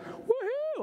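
high whoop like a Super Bowl win; mock cheer. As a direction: cheer as if your team just won. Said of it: "you celebrate like you won the Super Bowl".

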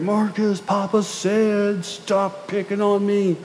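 lightly voiced little boy calling out slowly; child yelling through the house. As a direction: imitate a small boy hollering at his brother, stretched out and drawn slow. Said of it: "He yelled".

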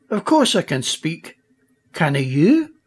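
put-on Scottish accent. Duncan the egg chieftain speaks.

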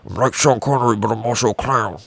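usual speaking voice

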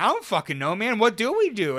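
put-on loud, high startled kid; panicked kid. Scared kid answering mom, loud and high. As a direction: cry it out like a scared eight-year-old. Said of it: "I'm like".

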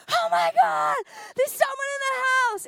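loud, high-pitched alarm; mock panicked shout. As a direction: cry out as if startling someone with an intruder. Brother frightens her, acted loudly.

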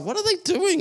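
playful imitation of a puzzled spouse. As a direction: toss it off like a confused partner. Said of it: "my spouse is like".

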